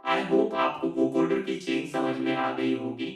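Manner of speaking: robotic vocoded voice blended with reverbed dry voice; vocoder with reverb. Shown as a demonstration. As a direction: talk through a vocoder mixed with your own voice. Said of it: "ये हमारे पास vocoder effect है".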